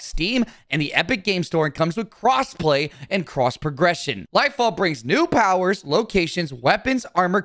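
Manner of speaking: upbeat sponsor read. Acted. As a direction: sell it like a channel sponsor spot.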